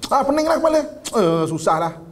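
high-pitched exasperated outburst, then dropping; spontaneous complaint. Acted. Person reacting before thinking, acted exasperated complaint. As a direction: blurt out a knee-jerk grumble. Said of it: "dia ada reaksi spontan".

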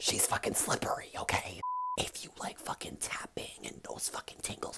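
normal talking voice

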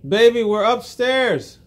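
slow, loud call upstairs. Real, not acted. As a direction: call up to her across the house. Dad calls to child upstairs.

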